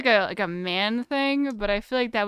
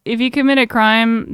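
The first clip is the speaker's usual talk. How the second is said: emphatic, louder warning to chat; 9 dB louder than usual. Real, not acted. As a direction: lay down the law, loud. Warning chat not to commit crimes.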